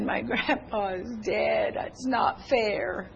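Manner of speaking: slow, soft mock wail; imitated crying child. Granddaughter tells grandparent about grandpa's death, imitated tearful wail. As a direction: imitate a little girl crying over a loss. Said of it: "And she was crying".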